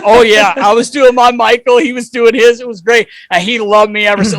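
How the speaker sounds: loud, high, thrilled retelling; excited. Real, not acted. Host telling the podcast about his dance off, loud and thrilled. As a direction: shout it out, reliving the win.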